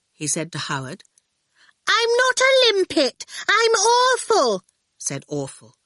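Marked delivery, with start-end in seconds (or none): 1.9-4.6 put-on indignant little girl. A narrator's reading. Little Orphle correcting Torquil, high and indignant. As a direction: correct him with small dignity.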